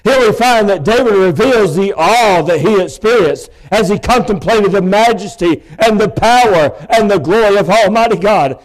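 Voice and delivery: ordinary talk, nothing marked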